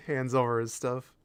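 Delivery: normal talking voice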